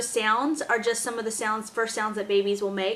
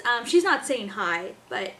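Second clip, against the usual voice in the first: normal talking voice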